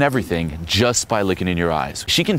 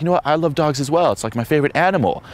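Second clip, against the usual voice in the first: higher, eager mock agreement; agreeable guy; 7 semitones higher, faster than usual. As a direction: imitate a guy eager to please. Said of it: "He's like".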